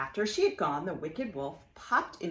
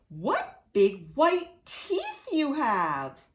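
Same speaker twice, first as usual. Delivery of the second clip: laughing wonder; 8 semitones higher, 6 dB louder, much slower than usual. Acted. Girl marvels at wolf's teeth.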